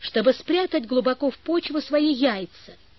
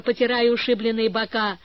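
ordinary delivery

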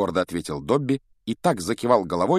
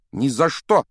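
indignant; 6 semitones higher, 7 dB louder than usual. A narrator's reading. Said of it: "вознегодовал Гарри".

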